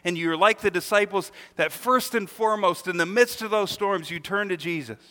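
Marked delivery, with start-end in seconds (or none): none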